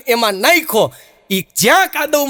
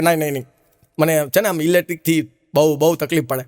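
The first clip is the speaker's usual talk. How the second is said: lower, softer pleading dead man; a hint of a fussy sinner begging off; mock whiny refusal; 8 semitones lower, 3 dB quieter than usual. Dead man refusing electric punishment.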